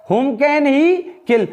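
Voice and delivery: each word drawn out slowly; slow, deliberate classroom reading; slow model reading. Shown as a demonstration. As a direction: say it slowly for students to repeat. Teacher models English question.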